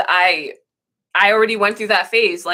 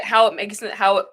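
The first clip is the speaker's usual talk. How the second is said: normal talking voice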